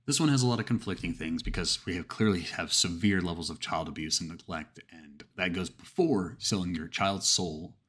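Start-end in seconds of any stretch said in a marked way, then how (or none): none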